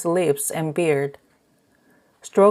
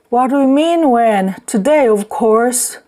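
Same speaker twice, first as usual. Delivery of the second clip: loud retort; 6 semitones higher, 5 dB louder than usual. Acted. Drunk man answers angrily.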